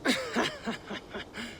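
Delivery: short high laugh, breathy and light; amused laugh. Acted. Sigurd laughing at Eivor's jab at Tana. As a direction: laugh at the jab despite yourself.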